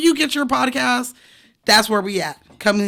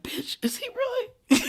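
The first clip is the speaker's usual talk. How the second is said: mock disbelief; 14 semitones higher, 6 dB quieter than usual.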